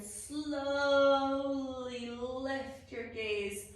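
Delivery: slow, stretched-out word; drawn-out guidance. Shown as a demonstration. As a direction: draw the word out to match the movement. Guides a slow deep breath.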